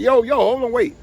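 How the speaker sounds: high, loud yo yo hold on; urgent calling out. Retold or imitated. Stepping between the two armed crews, he shouts at them to stop. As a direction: stop them fast, raise your voice.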